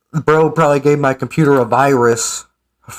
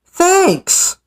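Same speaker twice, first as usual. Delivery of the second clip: mock impression, climbing higher and higher in pitch; Ryback voice; 6 semitones higher than usual. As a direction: read the reply as a wrestler's mocking imitation, rising steadily higher. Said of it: "try to do my Ryback voice".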